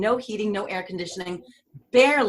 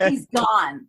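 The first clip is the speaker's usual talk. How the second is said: ordinary talk, nothing marked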